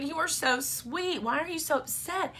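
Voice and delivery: high-pitched motherly cooing; tender, soothing. Retold or imitated. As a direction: coo it to a child you are hugging. Mother hugs and comforts her son.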